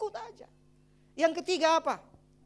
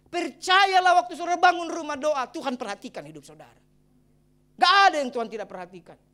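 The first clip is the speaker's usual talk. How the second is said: louder and higher, insistent preaching; preaching at full force; 4 semitones higher, 10 dB louder than usual. Real, not acted. Pastor assuring the congregation, preaching loudly. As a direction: drive the promise home, fast at the end.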